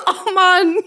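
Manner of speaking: spluttering after choking on a drink. Real, not acted. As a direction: cough out a choked sip. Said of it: "Jetzt hab ich mich voll verschluckt".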